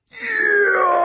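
very high, long-held screaming bellow. A narrator's reading. Steward bellows his name at the ceiling, howling. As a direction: howl the name on one endless note. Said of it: "and bellowed at the ceiling".

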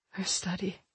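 half-asleep mumble, barely voiced; mumbled. A narrator's reading. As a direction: murmur it as you drift off. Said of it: "Polaire mumbled".

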